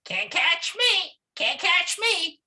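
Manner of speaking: very high, squeaky little monkey taunt; singsong teasing, far above normal pitch. Acted. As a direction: stretch it out in a tiny high voice. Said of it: "teasing Mister Alligator".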